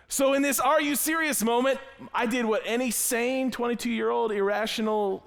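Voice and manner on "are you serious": high, quick repeat of the outburst; a clipped, higher echo of the shock; quick disbelief. Retold or imitated. Retold same outrage, shorter.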